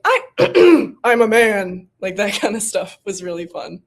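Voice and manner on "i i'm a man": a louder put-on man's voice, clearing the throat first; mock manly voice. Actress imitating Rosalind faking a man, hammed-up. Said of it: "showing little glimpses of".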